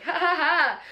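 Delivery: high mock laugh for the camera; posed laugh. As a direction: fake a laugh for a clip. Posed laugh for a boomerang clip.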